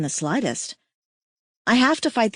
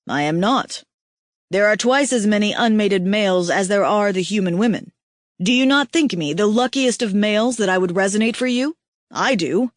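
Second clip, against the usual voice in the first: lightly voiced tender alien mate; 4 dB louder than usual. Aihako teasing weeping Kira, lightly voiced male mate. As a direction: voice the big mate, warm and teasing.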